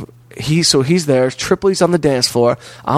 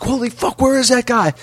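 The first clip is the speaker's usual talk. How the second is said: high-pitched imitation of an alarmed friend; retold high, urgent outburst; mock alarm; 10 semitones higher than usual. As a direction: pitch it way up, demanding to know. Friend arrives too late for attacker.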